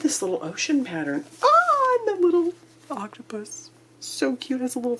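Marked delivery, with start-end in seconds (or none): 1.4-5.0 much higher, squealing with delight; excited squeal. Real, not acted. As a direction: pitch jumps up in sheer delight. Squealing over cute octopus print.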